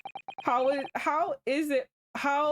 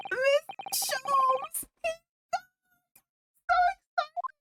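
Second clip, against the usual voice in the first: put-on much higher, thrilled girlish voice; high excited girl. Iris cheering for Sholmes, high excited girl voice. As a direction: squeal the name with delight.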